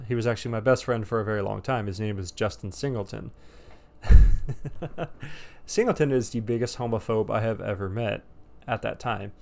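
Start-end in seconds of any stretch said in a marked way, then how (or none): 4.0-5.6 breathy chuckling burst; laugh. Real, not acted. Cracking up after naming his friend, laughing. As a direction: burst of laughter, loud at the start.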